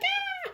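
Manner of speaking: very high happy scream; shrill shriek of celebration; joyful scream. Retold or imitated. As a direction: scream with pure joy. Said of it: "I'm screaming like".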